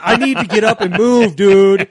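loud, high mock complaint; exasperated player. Rookie fed up with hours of sitting, mock exasperated outburst. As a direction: whine it loudly, imitating a restless player.

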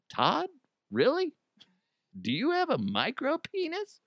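high, mocking questioning voice; mock customs agent. Customs agent mocking Todd, high incredulous voice. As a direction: pitch it way up in disbelief.

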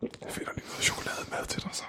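hushed whisper to a fading friend. Acted. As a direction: whisper it gently. Host whispers to fainting guest.

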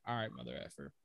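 low, quiet retort in a player's voice; muttered trash talk. Retold or imitated. Podcaster retells player answering Dillon Brooks, low muttered trash talk. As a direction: imitate a low-key warning under the breath.

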